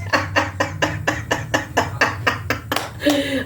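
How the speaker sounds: quiet, breathy giggling that goes on; soft laughter. Real, not acted. Still giggling softly at the holy trash panda. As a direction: keep laughing under your breath.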